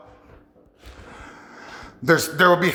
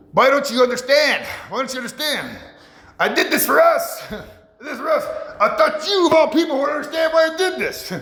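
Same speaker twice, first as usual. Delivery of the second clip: high, rising, hammed-up outburst that builds to a shout; mock angry husband; 8 semitones higher than usual. Husband snapping at his wife who won't understand, high hammed-up shouting. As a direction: play a frustrated husband getting more and more worked up. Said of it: "he gets mad at her and says".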